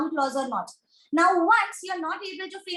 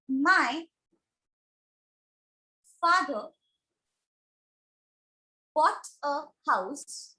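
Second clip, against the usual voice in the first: word by word, with long pauses; slow dictation; slower than usual. Real, not acted. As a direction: dictate it slowly for a class writing it down. Dictating an example sentence while writing.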